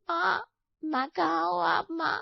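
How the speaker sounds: high, drawn-out wail for mother; tearful calling. Acted. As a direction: cry out for your mother in pain. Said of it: "रो क्यों रही है?". (रोते हुए माँ को पुकारना)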